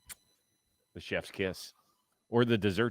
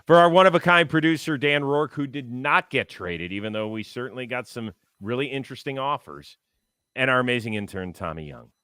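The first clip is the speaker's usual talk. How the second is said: announcer sign-off; 5 dB louder than usual. Acted.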